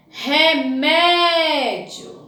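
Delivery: Remédio very slow, with a long stressed mé; strong syllable aloud. Shown as a demonstration. Teacher showing pupils the strong syllable, Remédio with drawn-out mé. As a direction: call out Remédio, stretching the mé. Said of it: "vamos pronunciar em voz alta e descobrir".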